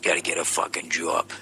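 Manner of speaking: low Boston accent, broad "job"; low townie Boston grumble. Shown as a demonstration. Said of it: "working on your Boston accents".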